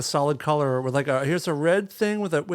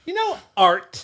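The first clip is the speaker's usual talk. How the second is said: pitched way up, mock art-snob "you know, art"; mock snooty; 13 semitones higher, 4 dB louder, slower than usual. Mocking friend's art talk with a mock snooty voice. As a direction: sneer it like a pretentious art critic.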